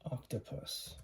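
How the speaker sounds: Octopus whispered with a short ah. Shown as a demonstration. Teacher showing the short o to the class, whispered. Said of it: "Short sounding".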